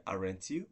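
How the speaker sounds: "aren't you?" starting low and lifting on "you"; rising intonation. Shown as a demonstration. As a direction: turn "aren't you?" upward into a real question. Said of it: "I'm using a rising intonation".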